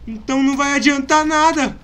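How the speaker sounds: sudden realization; alarmed. Real, not acted. Percebe que renascem. (surpreso e alarmado)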